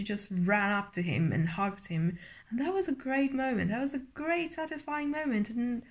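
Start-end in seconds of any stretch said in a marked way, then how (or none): none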